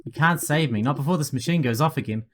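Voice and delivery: ordinary delivery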